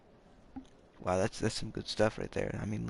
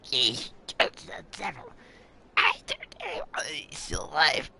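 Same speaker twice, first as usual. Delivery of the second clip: character voice; 4 semitones higher, slower than usual. Acted. Streamer voices game dialogue.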